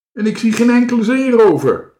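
high, loud call; loud announcement. A narrator's reading. Dikkie Druif looks out over the island, loud announcement. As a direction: call it out across the water. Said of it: "zei Dikkie Druif".

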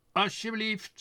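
high, loud, solemn bird voice; solemn crow. A narrator's reading. Quark presents the papers to Tos, high and solemn. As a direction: present it grandly as a proud crow. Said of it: "zei Quark plechtig".

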